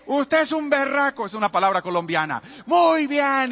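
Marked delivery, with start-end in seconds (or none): 0.1-1.3 higher, louder admiring exclaim; men praising the boy in excitement; excited praise. Retold or imitated. 2.7-3.5 higher, louder cheering; excited praise. Retold or imitated. Men praising the boy.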